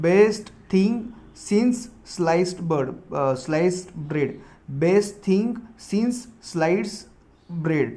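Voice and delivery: drawn-out teaching read; very slow dictation. Shown as a demonstration. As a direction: dictate for students to copy. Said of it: "dictating idiom very slowly".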